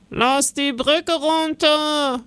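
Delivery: high, slow call from the walls; guard calling out. A narrator's reading. Guard on the tower calls down to open the gate, slow high call. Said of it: "ruft plötzlich ein Wachmann".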